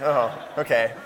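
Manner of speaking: ordinary talk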